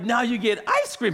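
high, celebratory promise; excited parent. Retold or imitated. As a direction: re-enact rewarding a toddler with delight. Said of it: "I'm so excited".

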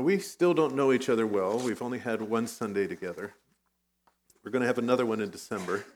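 ordinary talk, nothing marked

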